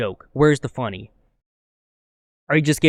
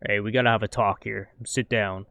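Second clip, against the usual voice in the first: low, mock-stern talking-to; serious talking-to; 4 semitones lower, 4 dB quieter than usual. How the comic came off to the room, low mock-serious lecture. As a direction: imitate a stern grown-up sitting someone down, pitched lower. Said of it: "but it came off like".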